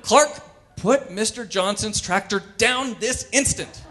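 hammed-up mom telling off her kid; scolding mother. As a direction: bark at the toddler like a fed-up mom. Mother scolding super toddler.

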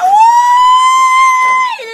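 a sudden, very high, shrill falsetto shriek; ecstatic shriek. Acted. Vlogger shrieking with delight at her own beauty. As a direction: squeal it out like you can't contain yourself.

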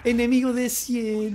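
loud, high, slow callout; calling out. Real, not acted. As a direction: call it out to the team. Calling out enemy. (aviso a gritos)

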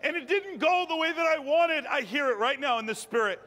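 high, impassioned exhortation; fervent preaching. Real, not acted. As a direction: preach it urgently, voicing the pleas and answers.

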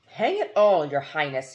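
loud, indignant military bluster; an exasperated old general huffing. Acted. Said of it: "he went pink in the face".

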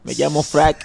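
normal talking voice

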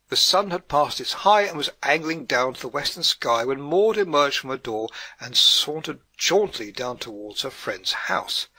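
ordinary delivery

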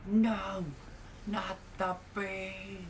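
Nicolas Cage. Retold or imitated. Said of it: "The best movie quote ever".

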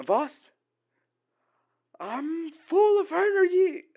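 loud, high meme guard impression; hammed-up burst of energy to the boss; pumped-up security guard. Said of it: "a security guard from, like, streets".

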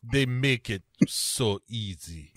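slow mock Russian villain; Russian accent. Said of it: "he says".

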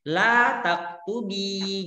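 la taktubi drawn out slowly with the final i held; slow model form. Shown as a demonstration. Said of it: "teacher models feminine form". (la taktubi diucapkan pelan dan panjang)

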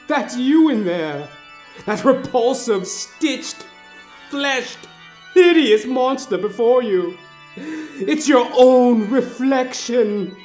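ordinary delivery, nothing marked

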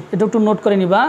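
usual speaking voice

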